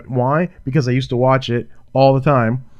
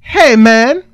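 excited; 10 semitones higher, 7 dB louder than usual. Real, not acted. Excited shout of the song title.

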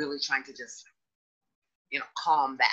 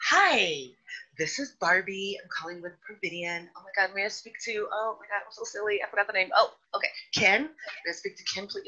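higher, bubbly customer-service voice; Barbie; 4 semitones higher than usual. Acted. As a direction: put on a ditzy, friendly telesales act. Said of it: "my name was Barbie".

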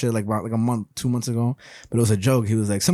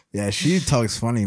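ordinary talk

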